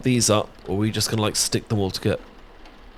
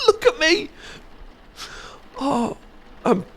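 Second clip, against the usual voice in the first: squealing laugh, much higher; 3 dB quieter than usual. Real, not acted. Friend laughing at her old photo, squealing with laughter.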